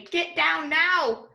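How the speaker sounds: slow stretched firm order; urgent command. Shown as a demonstration. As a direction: order a child off a chair. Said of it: "In my sentence today, I say".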